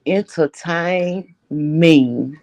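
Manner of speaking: very slow "Entertain me", each word stretched; drawn-out demand. Real, not acted. Co-host relishing the gossip, slow emphatic "Entertain me". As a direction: draw out "Entertain me" syllable by syllable.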